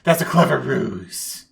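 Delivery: expose the trick with drama; hammy detective calling a bluff; suspicious detective. Acted. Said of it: "the detective goes".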